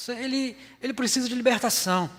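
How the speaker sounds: pena fingida em voz bem mais aguda; falsa compaixão. Retold or imitated. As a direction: imite quem tem dó do coitado. Mocking those who blame the demon. (tom agudo de piedade irônica)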